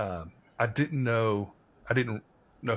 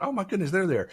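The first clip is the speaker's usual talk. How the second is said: much higher, delighted and warm; thrilled gush; 9 semitones higher, faster than usual. Retold or imitated. Friend excitedly noticing the grandparents, gushing and delighted. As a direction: squeal it like you're overjoyed they came.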